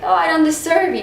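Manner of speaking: high, mock-modest delivery; funny sarcasm. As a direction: play up the false humility. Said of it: "that line is so funny".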